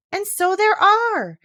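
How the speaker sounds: high, slow, thrilled exclamation; delighted. Acted. Alice tells the Red Queen, delighted and high. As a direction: let the delight lift the whole phrase. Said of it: "she added in a tone of delight".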